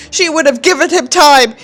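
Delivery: ordinary talk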